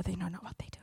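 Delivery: soft unvoiced prayer; whispered prayer. Preacher whispers prayer for others. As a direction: murmur it under your breath to God.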